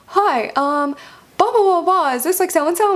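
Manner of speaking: clinic caller. Retold or imitated. Clinic phoning a patient with results, high mock caller voice.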